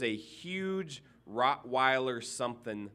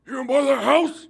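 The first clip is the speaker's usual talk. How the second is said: loud, high-pitched hollered challenge; gruff neighbor shout; 13 semitones higher, 13 dB louder than usual. Retold or imitated. Neighbor shouting from his porch at the new buyer, loud and high. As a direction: bellow it across the yard at the stranger. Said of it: "he goes".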